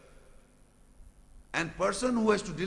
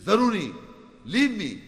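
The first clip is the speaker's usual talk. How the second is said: louder proud leave me; Pharaoh's boast; 3 semitones higher, 7 dB louder than usual. Acted. Preacher voicing Pharaoh to the crowd, loud boast. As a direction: throw it out like a tyrant's boast.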